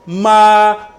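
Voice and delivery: "Ma" held long, high and level; first tone. Shown as a demonstration. Teacher has class repeat the first tone, high level "Ma". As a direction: sustain "Ma" loudly on one high pitch. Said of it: "le premier ton".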